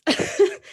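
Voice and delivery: short breathy nervous laugh. Real, not acted. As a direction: laugh off the nerves. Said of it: "I'm nervous".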